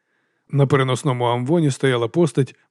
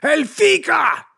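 high, drawn-out alarmed shout; much slower than usual. A narrator's reading. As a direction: cry out from the crowd. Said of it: "закричав хтось".

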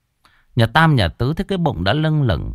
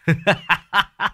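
put-on high, gleeful chuckle of a pleased old man; delighted old man's laugh; 9 semitones higher than usual. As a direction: cackle with joy in a high old voice. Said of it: "Ông cụ Bạch cũng hân hoan". (tiếng cười khoái chí của ông cụ)

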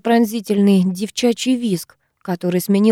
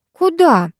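high, startled question; surprised; 9 semitones higher than usual. A narrator's reading. Вита being led away by classmate, surprised. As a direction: ask in surprise, pitch jumping up. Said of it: "удивилась девчонка".

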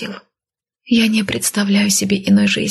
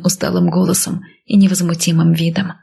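plain reading voice

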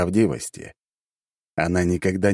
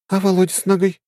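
high, puzzled question; surprised young woman; 4 dB louder than usual. Acted. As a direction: caught off guard. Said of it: "удивилась Аня".

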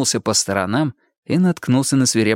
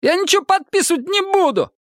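very high-pitched defiant outburst; spiteful refusal. A narrator's reading. Detainee refusing to sign anything, high spiteful outburst. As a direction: refuse in a thin, angry squeal. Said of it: "Лицо Лысого теперь злобно исказилось".

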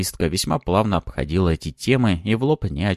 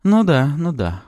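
high, drawn-out teasing agreement; smiling disbelief; 9 semitones higher, 4 dB louder than usual. A narrator's reading. Woman humouring Maxim's modesty, smiling and drawn-out. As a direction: slowly humour him with a smile. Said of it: "улыбалась та".

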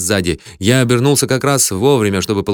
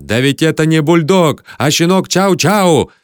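exasperated, raised-pitch protest; yelling; 5 semitones higher, 3 dB louder than usual. A narrator's reading. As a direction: yell the correction, voice pitched up. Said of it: "орал я". (возмущённо орать, повысив голос)

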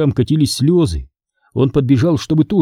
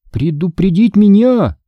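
slow, high echoing question; high-pitched, drawn-out indignation; incredulous; 7 semitones higher than usual. A narrator's reading. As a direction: repeat it back slowly in disbelief. Boy disbelieves her explanation.

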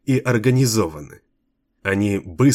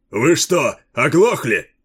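loud, scornful captain; angry shout; 5 semitones higher, 3 dB louder, slower than usual. A narrator's reading. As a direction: yell it at the crowd. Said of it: "скомандовал капитан".

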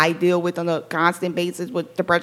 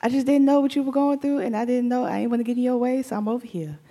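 sheepish friendly voice; 5 semitones higher than usual. Retold or imitated. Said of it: "and be like".

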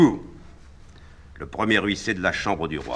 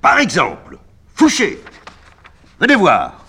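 higher, louder sudden outcry; exclaiming; 18 dB louder than usual. A narrator's reading. Napoléon suddenly cries out to Fouché, loud and high. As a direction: call him over, startled. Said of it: "Soudain, il s'écrie".

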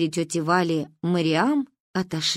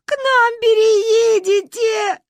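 high, loud slow crying; tearful; 14 semitones higher, 7 dB louder, much slower than usual. A narrator's reading. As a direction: console through sobs. Comforting while crying. (сквозь плач)

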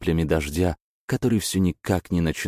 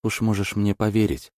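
quick, higher nervous chatter; rattling on nervously; 6 semitones higher than usual. Acted. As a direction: babble it out, anxious. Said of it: "тараторила она".

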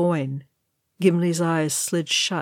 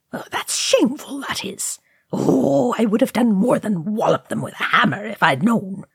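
higher-pitched fussing outrage; indignant; 4 semitones higher than usual. A narrator's reading. Ori scolding the orcs over his book, indignant. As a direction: splutter on in a higher, affronted character voice. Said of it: "he said indignantly".

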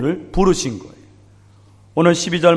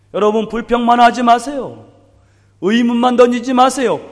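fervent exhortation; 7 semitones higher, 3 dB louder than usual. Real, not acted. Preacher exhorts congregation.

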